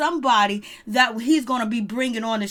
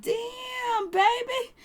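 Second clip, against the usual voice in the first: put-on much higher, falsetto damn baby; shocked squeak; 12 semitones higher, much slower than usual. Reacting to Angela's reveal, high falsetto shocked squeal. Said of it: "And I was just like".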